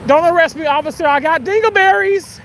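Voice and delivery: beg, panicked and loud; panicked pleading. Pretend. Pleading with the police.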